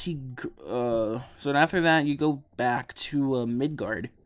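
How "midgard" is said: ordinary delivery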